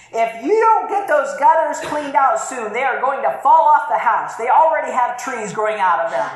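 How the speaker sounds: wife snapping an order at her husband; demanding tone. Shown as a demonstration. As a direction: push it as a pushy ultimatum. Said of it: "here's the demand".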